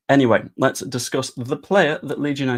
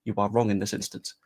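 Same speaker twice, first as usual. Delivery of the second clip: ordinary talk, nothing marked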